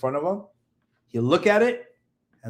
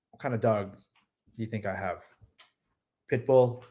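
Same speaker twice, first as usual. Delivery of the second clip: ordinary talk, nothing marked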